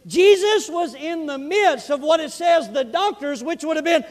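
loud, high, driving sermon voice; fiery preaching. Real, not acted. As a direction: preach it out with full force. Preaching at full force.

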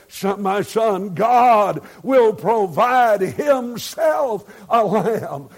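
slow, loud solemn proclamation; Abraham's promise called out; proclaiming shout. Acted. Abraham answers Isaac solemnly.

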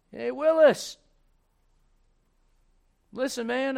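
high, hailing Southern farmer; Southern accent. Acted. Farmer to the farm boy Willis, Southern-accented hail. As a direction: call out in a put-on Southern accent. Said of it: "I'm gonna do this for Miss Cindy 'cause she's from the South".

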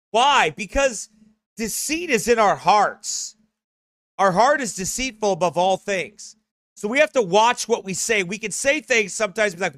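loud, rising sermon voice; full-force preaching. Acted. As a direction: drive the warning home to the congregation.

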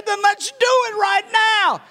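high, loud, urgent call; excited shout. Retold or imitated. Preacher urges weeping Joe Reed to pray now, with a high shout. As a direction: shout it, pushing him to act now.

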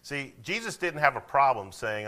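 ordinary delivery

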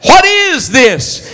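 imitating a baffled complaint, slowly; mock bewildered question. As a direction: stretch the question out in disbelief. Preacher repeating the people's question.

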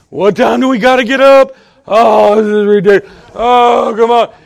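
loud, whiny protest; grumbling complaint. Acted. Preacher acts grumbler on Sunday morning, loud complaining. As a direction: moan and gripe about having to get up.

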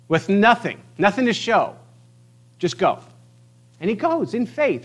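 impassioned preaching. Real, not acted. Preacher urging the congregation on Abram's faith, impassioned. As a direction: press the point with fervor.

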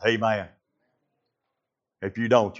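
usual speaking voice

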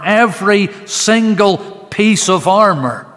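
loud, high, fervent preaching; preaching at full force. Acted. Preacher urging listeners to take the whole armor, fervent loud preaching. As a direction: drive home the completeness with fervour.

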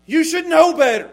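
put-on loud, high telling-off of a child; play-acted scolding, voice jumping up; mock parental rebuke. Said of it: "like we could our kids".

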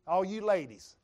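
usual speaking voice

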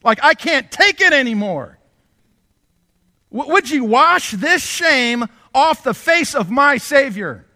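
high, loud and slow outburst; exclaiming wife. Retold or imitated. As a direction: urge her husband with burning outrage. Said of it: "and exclaimed".

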